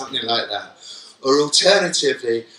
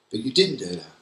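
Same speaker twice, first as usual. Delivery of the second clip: usual speaking voice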